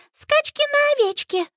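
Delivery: put-on very high little-girl voice; squeaky, insistent child's demand; stubborn toddler yell. A narrator's reading. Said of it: "упрямо орёт вдруг Минни".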